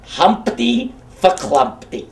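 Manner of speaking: slow, drawn-out puppet voice; dopey Humpty Dumpty voice. Acted. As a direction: savour the silly name like the dopey egg. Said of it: "that's his voice".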